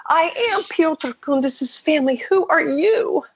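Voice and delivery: high, overcome voice, slowing on the question; retold tearful. Old woman questions her visitor while crying, retold tearfully. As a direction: imitate a woman shaken by the news. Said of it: "she starts crying and shaking".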